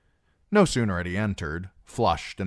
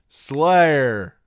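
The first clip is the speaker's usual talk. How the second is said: put-on loud, high drunken hail; tipsy singer calling out; 8 semitones higher, 9 dB louder than usual. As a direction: holler it like a merry drunk. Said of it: "the singer called out drunkenly".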